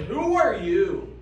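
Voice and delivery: loud, slow "Who are you?" demand; startled queen shout. Acted. Queen wakes to stranger.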